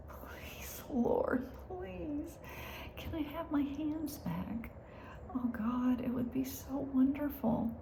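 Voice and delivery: soft intimate plea for healing; whispered plea. Retold or imitated. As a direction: whisper a longing prayer to God. Her pleading prayer for hands.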